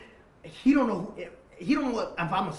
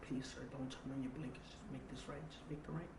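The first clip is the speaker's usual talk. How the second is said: much softer, tense instructions; hushed plea; 13 dB quieter than usual. Retold or imitated. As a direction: keep it down so no one outside hears. Hushed instructions hiding from shooters.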